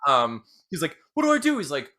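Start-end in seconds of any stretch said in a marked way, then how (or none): 1.1-1.6 high helpless cry; acted panicky plea; freaking out. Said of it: "freaking out".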